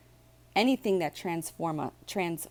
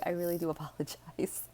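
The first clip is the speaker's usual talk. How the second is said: laughing apology; 7 dB quieter, faster than usual. Real, not acted. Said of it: "Sorry for the slurp".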